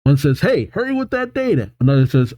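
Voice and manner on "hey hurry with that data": urgent assistant. Acted. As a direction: hurry a colleague along in a high voice. Assistants arguing, one urges another.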